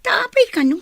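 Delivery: high, loud startled cry; alarmed, loud and high-pitched; shocked outburst. Acted. Shocked at frozen bank account.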